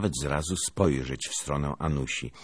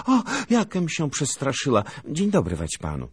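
high, louder startled exclamation; startled girl; 10 semitones higher, 5 dB louder than usual. A narrator's reading. Anusia bumps into the lieutenant in the corridor, startled. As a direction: gasp out the fright in a high voice.

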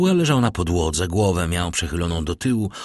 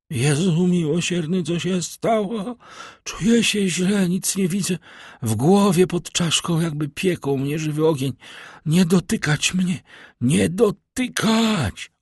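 much higher, slowed, strangled cries of pain; a poisoned man begging them not to touch him; agonized terror; 10 semitones higher than usual. A narrator's reading. The poisoned servant cries out to his master in terror and pain.

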